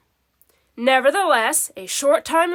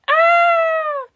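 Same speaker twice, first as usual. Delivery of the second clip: high piercing scream, louder; terrified scream; 4 dB louder than usual. A narrator's reading. Cat screams at the floating phantom, high terrified scream. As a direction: scream in sudden terror. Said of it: "screamed Garfield".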